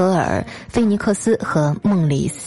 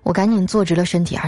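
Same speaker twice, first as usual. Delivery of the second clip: normal talking voice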